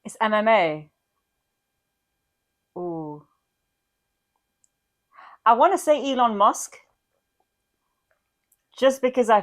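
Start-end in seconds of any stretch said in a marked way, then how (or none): none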